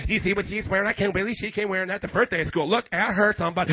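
low, soft catty aside; gossiping lunchroom ladies. Acted. Preacher mimics ladies in the lunchroom, catty gossip. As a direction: imitate catty gossip about an outfit. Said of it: "what you ladies would do in the lunchroom".

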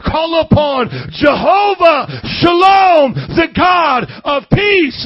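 loud, slow, swelling preacher's cry; full-force preaching. Real, not acted. Preacher challenging people to call upon God, shouted preaching.

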